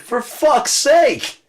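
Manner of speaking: high, loud and slow; exasperated outburst. Real, not acted. Ranting that the constitution is not holy, an exasperated outburst. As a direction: drag it out in exasperation. Rant peak.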